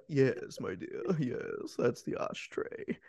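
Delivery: mock hushed movie-character voice; Ed Harris. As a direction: play a hushed, knowing reply to a dear one. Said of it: "Ed Harris is just looking at it like".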